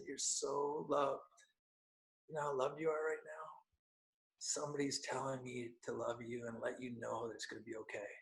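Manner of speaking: a soft tender reassurance that sinks to a whisper. Retold or imitated. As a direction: comfort her gently, barely above a breath. Tender message to checkout girl.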